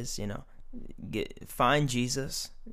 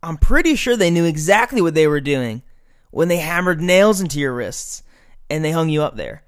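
louder, higher preaching at full force; fired-up preaching; 4 semitones higher, 8 dB louder than usual. Acted. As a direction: preach it with fire, pressing the point. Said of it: "I'll get fired up".

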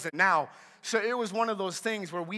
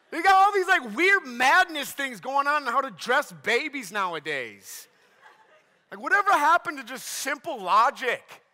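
mock outrage easing off from a shout; high, loud bewildered complaint, slowing at the end; comic exasperated rant; 6 semitones higher, 6 dB louder than usual. Preacher complaining to the congregation about baby clothes, mock-exasperated. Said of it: "working through my anger issues".

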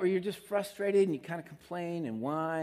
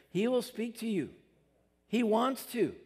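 ordinary talk, nothing marked

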